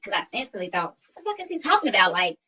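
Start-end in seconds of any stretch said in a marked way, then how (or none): 1.1-2.1 much higher, puzzled disbelief; retold disbelief. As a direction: ask it as if the question makes no sense. Retold inner confused thought.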